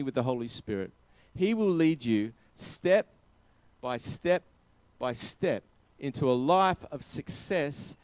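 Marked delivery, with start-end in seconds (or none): none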